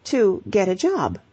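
ordinary delivery

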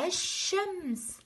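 teacher shows first-graders the sun lam, الشمس with silent l, drawn out. Shown as a demonstration. Teacher reminding pupils of the sun lam, silent l in الشمس. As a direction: say الشمس slowly, the l unsounded, straight into a long sh. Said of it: "كتبتها ولم ألفظها".

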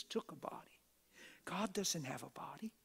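hushed, unvoiced aside; dramatic whisper. Real, not acted. Preacher lowering voice to a whisper for emphasis. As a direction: drop to a secret whisper for the congregation.

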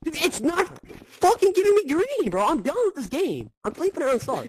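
high, loud frustrated rant; raging gamer. Real, not acted. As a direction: yell it out, fed up with the game. Raging at the game lagging.

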